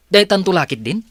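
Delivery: annoyed outburst. Acted. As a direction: snap out the frustration. Boss complains about employee. (nauyong a panagsao)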